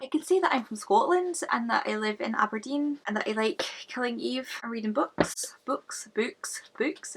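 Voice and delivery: attempted Scottish accent, softly tried out. Shown as a demonstration. Vlogger showing she can't convincingly do accents, attempted Scottish accent. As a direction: have a tentative go at a Scottish accent. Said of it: "to do a Scottish accent".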